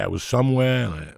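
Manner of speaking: ordinary delivery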